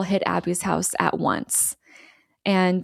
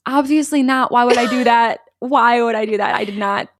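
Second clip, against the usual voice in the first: mildly defensive, raised pitch at first; indignant retold denial; 3 semitones higher, 4 dB louder than usual. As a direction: push back at an accusation, in retelling.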